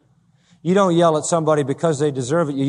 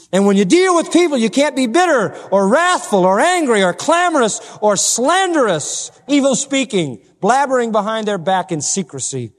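raised, high-pitched sermon exhortation; forceful preaching; 5 semitones higher than usual. Acted. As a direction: drive the list home, high and emphatic.

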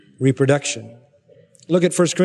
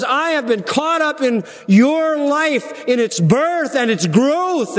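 high, slow, stretched pulpit cry; impassioned preaching; 8 semitones higher, slower than usual. Real, not acted. Preacher voicing Paul to his church, impassioned preaching. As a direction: stretch the key words with rising fervor.

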